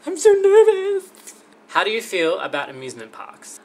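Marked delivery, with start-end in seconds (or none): 0.1-1.1 much higher, louder, slow squeaky panic; mock nervous squeal. Showing viewers her nervous habit, hammed-up high squeal. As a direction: squeal it like a cartoon bundle of nerves. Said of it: "I pull on my ears like this".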